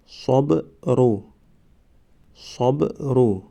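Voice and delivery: صبرو stretched very slowly, syllable by syllable; drawn-out demo. Shown as a demonstration. Teacher modelling the word for students, very slow. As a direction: draw out صبرو slowly for the class. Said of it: "ما باید همان حرف را فقط آوازش را تلفظ کنیم".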